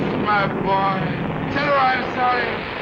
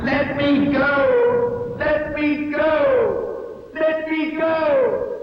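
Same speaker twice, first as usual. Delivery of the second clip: slow, drawn-out higher shouts; anguished cry; 4 semitones higher, much slower than usual. Acted. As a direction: cry out as if letting go of life. Dying pilot cries out, shout heard.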